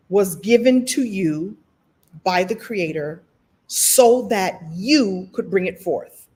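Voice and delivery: slow, weighty phrasing with extra punch; with emphasis. Shown as a demonstration. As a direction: lean into every phrase, give it funk. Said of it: "let me put some funk on it".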